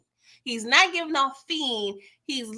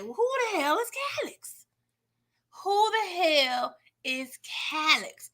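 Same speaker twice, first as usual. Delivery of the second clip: bewildered question; 5 semitones higher, 3 dB quieter than usual. Retold or imitated.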